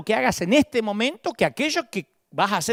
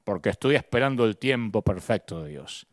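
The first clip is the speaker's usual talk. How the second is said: mock pious excuse; 10 semitones lower than usual. Mocking a passive believer.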